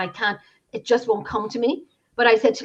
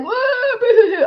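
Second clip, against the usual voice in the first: high-pitched, hammed-up wail; mock bawling; 14 semitones higher, 7 dB louder, slower than usual. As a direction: fake a big boo-hoo cry, much higher than usual. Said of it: "I wasn't like".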